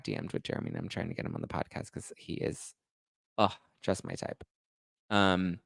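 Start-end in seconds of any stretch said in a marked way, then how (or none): 0.0-4.4 whispered aside, unvoiced and soft; hushed confession. Real, not acted. Host confessing her crush on Jeremy to listeners, hushed whisper.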